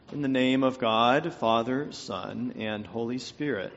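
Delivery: low, subdued prayer voice; measured prayer, softening at the end; opening prayer. Acted. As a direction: pray it solemnly, voice sinking toward the Amen.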